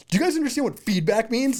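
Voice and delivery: indignant retold outburst, high and loud; loud, high-pitched exasperated retort; angry. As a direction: demand it in mock-angry outrage. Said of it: "I was, like, angry".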